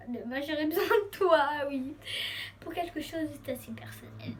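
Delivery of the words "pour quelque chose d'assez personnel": whisper. Real, not acted. Confiding a personal favour.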